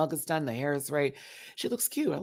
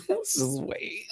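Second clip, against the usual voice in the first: laughing through it; slower than usual. Pretend. Watching the ranking, laughing at herself. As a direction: giggle the words out, slowly. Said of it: "That's me".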